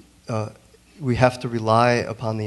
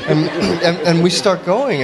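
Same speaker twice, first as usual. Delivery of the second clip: laughing through the words, higher and louder, slowed; 8 semitones higher, 7 dB louder, slower than usual. Real, not acted. Recalling the excited studio moment with Horace, laughing. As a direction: caught up in real laughter while recalling it. Laughing over the Photoshop edits.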